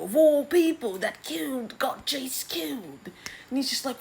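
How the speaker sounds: a cartoon dad losing it at his daughter; exasperated father. Daemon scolding his daughter Rhaena, high outraged whiny voice.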